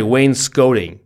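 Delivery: "wainscoting" with "scot" as "scoat"; slow "wainscoting" with a long o in the middle; corrected pronunciation. Shown as a demonstration. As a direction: say WAYNE-skoh-ting for "wainscoting". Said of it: "Sorry, wainscoting".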